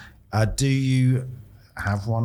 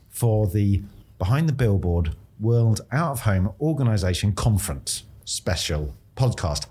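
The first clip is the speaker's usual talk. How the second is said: word by word through a long title; slow, careful; 3 semitones lower than usual. Real, not acted. Host reads out a tongue-twisting event title, slowly. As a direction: pick through the name slowly, falling lower towards the end. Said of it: "I'm gonna say this slowly".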